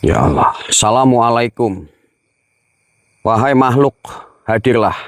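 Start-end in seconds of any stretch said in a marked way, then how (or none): none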